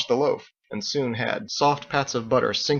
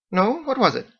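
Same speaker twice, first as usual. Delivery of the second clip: high, bright-toned Irish-style hostess; Margot's lilting voice; 10 semitones higher, 3 dB louder than usual. A narrator's reading. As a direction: play the chatty innkeeper woman, high.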